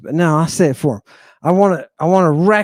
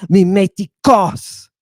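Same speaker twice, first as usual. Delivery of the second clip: MIMETIKOS slow and higher, punched on the final KOS; hit the accent; 6 semitones higher, 4 dB louder, slower than usual. Shown as a demonstration. Teacher repeating the Greek word for students, accented last syllable. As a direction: land hard on the end of MIMETIKOS. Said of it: "You hear that time I hit the accent".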